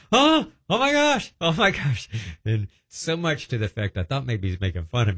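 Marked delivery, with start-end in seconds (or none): 0.1-2.1 high, slow amazed cry; hollering. Retold or imitated. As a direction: cry out in shock, much higher. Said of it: "he starts hollering".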